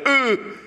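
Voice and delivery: sharp high grunt of a man falling; a hammed-up fall grunt; stumbling grunt. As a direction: grunt as you hit the ground.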